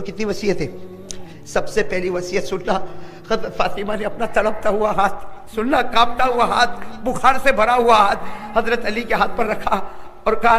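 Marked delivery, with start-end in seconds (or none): none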